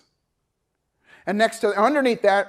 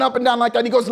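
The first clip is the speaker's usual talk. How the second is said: ordinary talk, nothing marked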